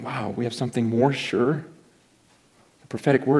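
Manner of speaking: hushed awe rising at "the prophetic word"; quiet wonder. Retold or imitated. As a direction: read it back softly, amazed. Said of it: "I just remember reading that phrase and going".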